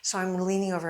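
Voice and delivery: ordinary delivery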